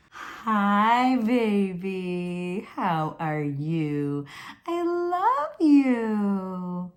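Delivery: put-on high sing-song cooing to a baby, stretched slow at the end; parentese. Shown as a demonstration. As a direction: coo it like a doting parent, drawing out I love you. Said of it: "Some people think that baby talk sounds like this".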